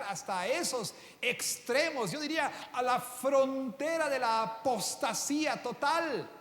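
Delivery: rising, slow and loud sermon peak; impassioned preaching. Acted. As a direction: build the sermon to its climax. Preaching climax.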